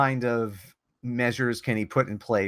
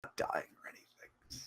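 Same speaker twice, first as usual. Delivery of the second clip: whispered aside; breathy unvoiced whisper; 16 dB quieter than usual. Wounded character whispering aside.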